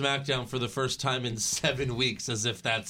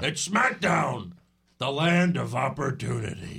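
mock promo. Boss pitching SmackDown to Dolph, mock grand promo.

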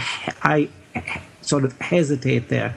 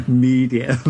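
laughing; 4 semitones higher than usual. Real, not acted. Laughs through a steak joke.

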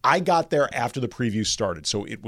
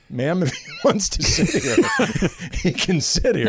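amused usher; 6 semitones higher than usual. Retold or imitated. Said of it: "the usher says".

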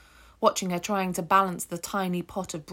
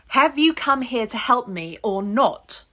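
louder and higher, impatient demand; angry demand; 5 semitones higher, 7 dB louder than usual. Acted. Ruth demanding a bucket from the stranger, angry and loud. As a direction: snap the question at him. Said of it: "and angry".

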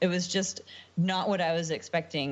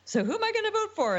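imitating a puzzled voter, higher; faster than usual. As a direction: toss it off high and quick. Voter imagined, quoted.

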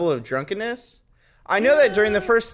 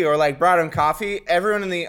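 ordinary delivery

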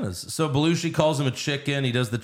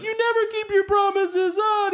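much higher whiny mock wail; mock crying kid. Podcaster mimics Jamie crying at Arnold on the phone, high whine. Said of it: "Jamie cries and he's like".

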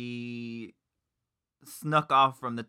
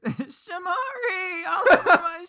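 wailing, high and slow; hammed-up tearful yell; mock crying shout; 6 dB louder, much slower than usual. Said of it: "She said".

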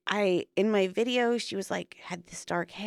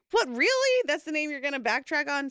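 high disbelieving yelp on "What, really?", then settling back down; incredulous retelling; 8 semitones higher than usual. Retold or imitated. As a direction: replay your shock at him backing out of a name. Retold disbelief at partner's pick.

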